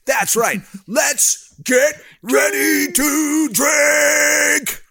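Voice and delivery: high, very slow shouted hype; fighting game announcer. Acted. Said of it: "do the announcer voice".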